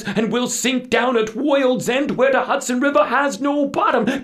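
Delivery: very high, loud, panicked character voice; frantic warning. A narrator's reading. Little Dutchman warning of imps and storms, frantic. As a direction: build to a high-pitched frenzy.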